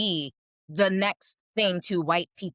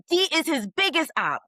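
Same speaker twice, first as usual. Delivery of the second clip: higher, emphatic, hammering Dee; heated insistence; 9 semitones higher than usual. Real, not acted. Co-host ranting about Drew's real enemy, heated and higher.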